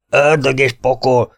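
slow, loud curse; angry oath. A narrator's reading. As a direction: spit out the curse in fury. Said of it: "kiáltotta Náró".

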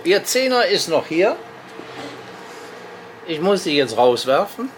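usual speaking voice

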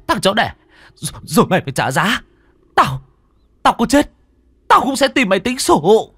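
high, loud and broken; stammered fury. Acted. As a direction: sputter threats, battered. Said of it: "lắp bắp được mấy câu".

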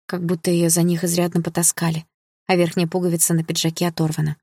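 ordinary delivery

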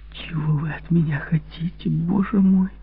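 breathless high whisper. Acted. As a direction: whisper it in distress. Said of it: "прошептала".

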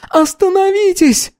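very high, stretched-out plea; wailing. A narrator's reading. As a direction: wail for them to stop. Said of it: "завыла Анна".

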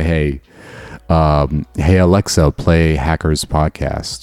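slow, clear command to a smart speaker; voice assistant command. Shown as a demonstration. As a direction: spell it out slowly for the device. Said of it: "you can just say".

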